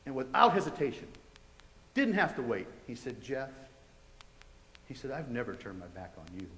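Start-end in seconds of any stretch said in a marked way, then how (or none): 3.2-3.5 retold divine voice, hushed; soft but clear. The voice answers without hesitation, soft and clear. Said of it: "real soft but real clear". 5.1-6.5 low, hushed reassurance; soft but clear. Retold or imitated. The voice answers a man who turned away, soft and clear. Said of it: "real soft but real clear".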